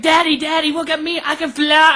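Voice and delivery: put-on high, eager little boy calling to his dad from the roof; a small son begging his dad to watch; imagined kid voice. Son on the roof calling down to his dad, eager and high. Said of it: "Be like".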